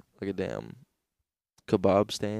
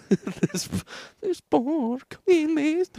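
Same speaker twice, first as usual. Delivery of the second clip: much higher, slow falsetto vendor; 5 dB louder, much slower than usual. Retold or imitated. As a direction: drag it out high and slow.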